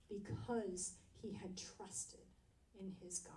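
scripture ending in a whisper; hushed reading. Acted. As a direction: drop to a near whisper on the last words. Dramatic close of scripture reading.

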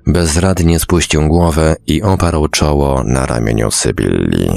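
usual speaking voice

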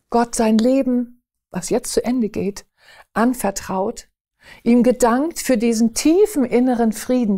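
usual speaking voice

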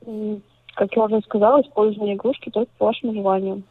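ordinary talk, nothing marked

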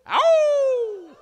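a long, very high and loud coyote howl. Shown as a demonstration. Performer demonstrates the coyote signal for the crowd with a long howl. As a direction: throw your head back and howl like a coyote. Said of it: "sounds of coyotes howling".

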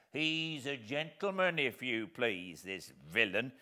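loud, slow, scornful rant; disdainful. Acted. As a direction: sneer at the idea with contempt. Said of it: "said my convict disdainfully".

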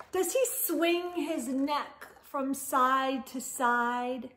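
lively storybook read-aloud; expressive picture-book narration; reading to children. Acted. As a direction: read it to kids at bedtime, animated.

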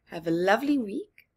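ordinary delivery, nothing marked

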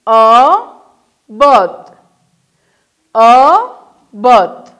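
drawn out and loud, split into syllables; هجا به هجا و آهسته; slow syllable-by-syllable. Shown as a demonstration. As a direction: read it slowly for the class. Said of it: "این را اگر بخوانیم".

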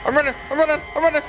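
flee while laughing in panic; panicked run. Real, not acted. Gamer escaping the monster, high panicked shouting.

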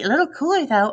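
high, sing-song imitation of her; mocking mimicry. A narrator's reading. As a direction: parrot her words to tease. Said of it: "He begins to mimic me".

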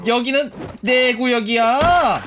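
slow, loud, high declaration; playful loud proclamation; territorial shout. Pretend. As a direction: stake your claim, stretching the words. Claiming territory from zombies.

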